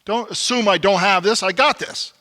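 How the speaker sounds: defensive husband brushing off wife; bristling defensiveness. Retold or imitated. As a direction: bristle at the advice. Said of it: "I bristle".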